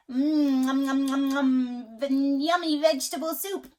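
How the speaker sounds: loud munching nom nom; nom nom eating. Acted. Parent reading to child, loud mock eating noises. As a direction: smack through it like gobbling yummy soup. Acting animals eating soup.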